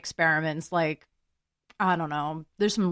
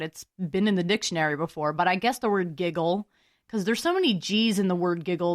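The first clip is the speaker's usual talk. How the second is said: usual speaking voice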